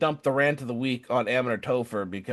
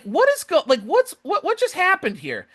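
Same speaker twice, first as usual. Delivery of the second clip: much higher, baffled outburst; bewildered; 12 semitones higher than usual. Retold or imitated. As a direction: sound stunned at the sudden change. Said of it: "And I'm like".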